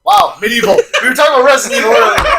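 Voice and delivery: fast, high laughing talk. Real, not acted. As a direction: laugh through the words. Mishearing joke, laughing.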